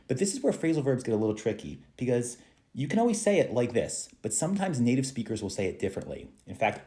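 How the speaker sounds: normal talking voice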